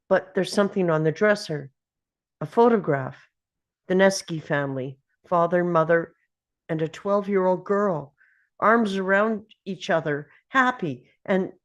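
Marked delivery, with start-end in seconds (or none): none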